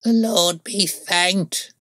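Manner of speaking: very high, slow cry of thankful relief; old crone exclaiming her thanks, drawn out high; old woman's cry. A narrator's reading. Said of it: "cried the old woman".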